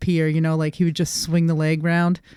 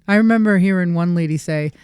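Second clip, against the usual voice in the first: usual speaking voice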